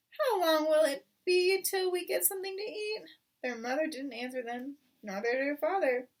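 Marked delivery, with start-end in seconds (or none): 0.1-3.0 put-on high, louder child voices; small foxes. Hungry cubs begging parents for food, high. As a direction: plead like hungry little cubs.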